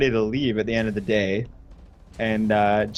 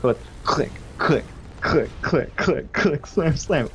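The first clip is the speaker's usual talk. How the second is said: click sounds; 3 semitones higher, slower than usual. Retold or imitated. Imitating tech deck noise.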